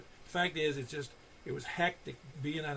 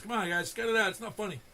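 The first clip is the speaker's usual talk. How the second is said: imitating an upset protest, louder on cut it out; a hurt plea to stop the teasing; 6 semitones higher, 6 dB louder than usual. Retelling the radio man begging the crew to stop. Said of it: "he would get upset".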